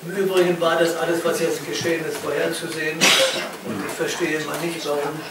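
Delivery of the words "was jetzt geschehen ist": usual speaking voice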